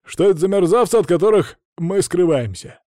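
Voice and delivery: high, loud, serious questioning; earnest driver. A narrator's reading. Driver asks Маша who is chasing them, serious. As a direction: demand it as the voluble driver. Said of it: "спросил Рэй серьёзным тоном".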